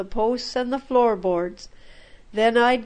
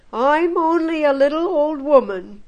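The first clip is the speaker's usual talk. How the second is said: put-on higher and louder old woman's lament; old woman complaining to the missionary, higher and louder; little old woman; 5 semitones higher, 4 dB louder than usual. A narrator's reading. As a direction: plead your helplessness as an old woman. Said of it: "she cried".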